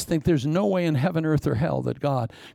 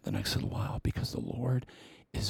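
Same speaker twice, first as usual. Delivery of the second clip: whisper; 3 semitones lower, 10 dB quieter, faster than usual. Acted. Preacher drops to a whisper.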